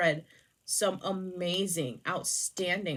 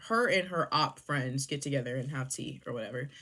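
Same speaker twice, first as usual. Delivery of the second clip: ordinary delivery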